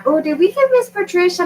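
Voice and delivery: high, excited quoting voice; chirpy voice of a customer; mimicking customers. Quoting people asking about link.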